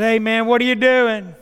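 hearty phone greeting. Retold or imitated. Man answering Leo's call, loud hearty greeting. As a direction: call out a big hello, drawn along.

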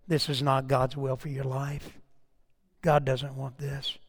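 low, hushed, earnest retelling; gentle counsel. Retold or imitated. Pastor tells runaway girl God doesn't want this, gently. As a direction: say it softly and low, with quiet gravity.

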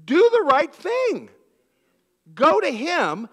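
high, loud and slow; loud exhortation. Acted. Preacher urging the church to go to the offender loudly. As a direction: proclaim it firmly and slowly.

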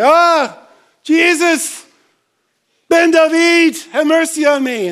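loud high call for mercy; first call. Retold or imitated. Beggar's first call to passing Jesus, loud high call. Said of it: "No, it's".

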